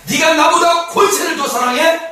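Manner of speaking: loud, very high, jealous interrogation; acted jealous outburst, pitched high; jealous demand. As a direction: fire off accusing questions as a jealous lover. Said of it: "질투의 그 화신이 되어서".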